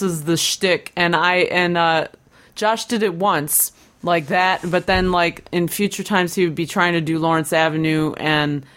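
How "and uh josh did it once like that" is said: usual speaking voice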